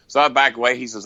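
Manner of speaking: ordinary talk, nothing marked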